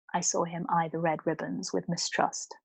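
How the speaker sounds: usual speaking voice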